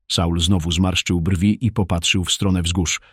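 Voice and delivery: plain reading voice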